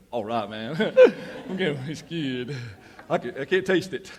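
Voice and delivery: laughing through a mock quote; laughing guy. A guy shrugging off the brownies, laughing. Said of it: "some guys that would have just said".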